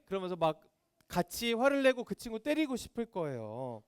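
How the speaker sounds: normal talking voice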